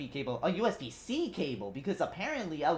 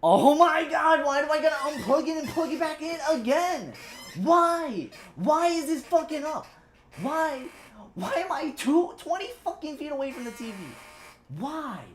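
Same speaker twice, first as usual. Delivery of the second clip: high, loud, exasperated ranting; real fury at the cable; complaining louder and higher with each why; angry; 9 semitones higher, 4 dB louder than usual. Real, not acted. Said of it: "sorry if I'm angry already".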